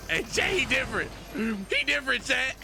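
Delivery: high, loud, hyped praise; excited high shouting. Real, not acted. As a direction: cheer him on to chat.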